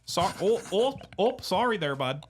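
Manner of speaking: put-on high, over-apologetic Michigan accent. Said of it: "this is how you talk".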